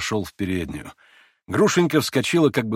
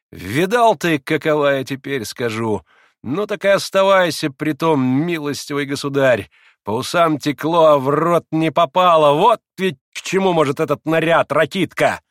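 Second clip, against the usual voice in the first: loud, raised-pitch malicious gloating; spiteful, heated jeer; spiteful taunt; 6 semitones higher, 7 dB louder than usual. A narrator's reading. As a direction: throw it out as a vicious taunt. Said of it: "закончила Грушенька со злобным смешком".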